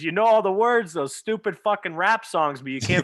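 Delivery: retold parental rant; scolding father. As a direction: berate him like a fed-up father. Father scolding son in retold story.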